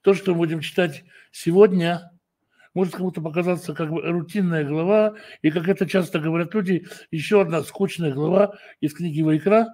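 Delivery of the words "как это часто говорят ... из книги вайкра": ordinary talk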